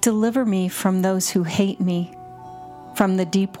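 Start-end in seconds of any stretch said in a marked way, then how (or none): none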